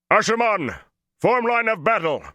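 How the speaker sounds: called out very high and loud across the camp; booming call. A narrator's reading. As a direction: make the voice boom over the battle. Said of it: "Taim boomed".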